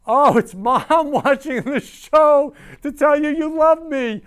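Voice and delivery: high, loud delighted squeal; thrilled, high-pitched outburst; overjoyed surprise. Real, not acted. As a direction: cry out as you suddenly spot your mom watching. Spots mom watching live.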